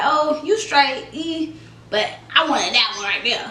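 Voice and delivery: normal talking voice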